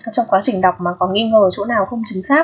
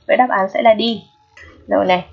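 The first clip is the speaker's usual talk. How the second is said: ordinary talk, nothing marked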